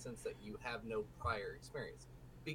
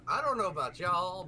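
louder and higher; 5 semitones higher, 8 dB louder than usual. Real, not acted. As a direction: raise the voice, louder and higher on y'all. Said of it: "And I'm over here going".

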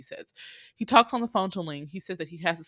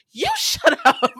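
loud, high, drawn-out comeback; imitated defiant yell; 10 semitones higher, 10 dB louder, slower than usual. Kidnapped Vanessa talking back to her captor, defiant mock yell. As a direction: mimic a little girl yelling right back.

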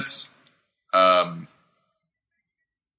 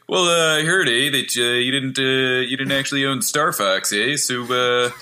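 Canadian customs officer; 3 semitones higher than usual. Pretend. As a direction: play a folksy Canadian customs agent with lots of eh. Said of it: "Toronto Customs, they're just like".

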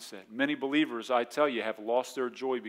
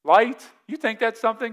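higher, louder mock disbelief; God scoffing; 8 semitones higher, 8 dB louder than usual. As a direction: scoff at the small complaint. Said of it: "God's like".

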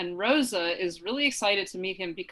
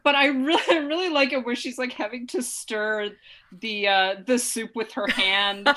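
ordinary talk, nothing marked

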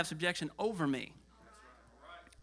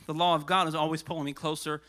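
usual speaking voice

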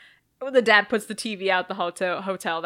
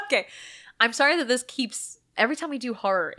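ordinary talk, nothing marked

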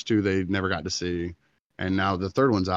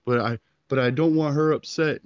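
retold sobbing; 8 semitones higher, 5 dB louder than usual. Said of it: "now he's sobbing and crying".

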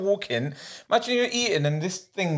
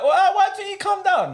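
much higher, sing-song aunt voice, laughing; mock auntie; 3 dB louder than usual. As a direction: imitate a cheery aunt inviting you over. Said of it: "My auntie's like".

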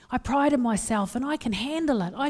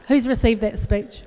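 ordinary delivery

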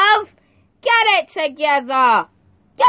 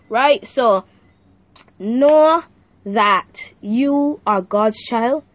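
ordinary delivery

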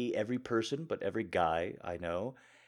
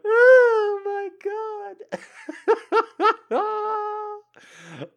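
high, loud, stretched Oh my God; a long loud squeal of laughter; shrieking laugh-cry; 17 dB louder, much slower than usual. Real, not acted. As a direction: scream it through laughter. Laughing at own story.

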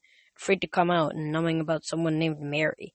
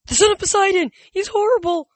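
very high, loud, strained shriek; terrified shriek; 9 dB louder than usual. A narrator's reading. Chris seeing the son of Poseidon, shrieking in terror. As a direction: shriek in horror at the sight of him.

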